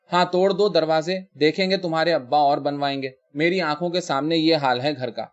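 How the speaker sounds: ordinary delivery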